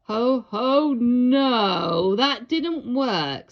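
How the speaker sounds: big jolly Santa voice, let down; a booming Santa who flopped; Santa, sighing. A narrator's reading. Santa sighing at the failed flying lesson. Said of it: "said Santa with a sigh".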